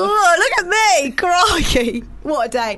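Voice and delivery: high, slow jolly "oh, look at me"; hammed-up breezy Brit. Covering up a fall with jolly chatter, hammed-up. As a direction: pretend nothing happened, cheerily. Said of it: "Or when you turn to someone".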